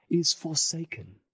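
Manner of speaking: ordinary talk, nothing marked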